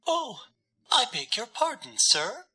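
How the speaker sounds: very high, fluttery and quick little dove voice; flustered bird apologizing in a rush; all aflutter. A narrator's reading. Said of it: "all aflutter".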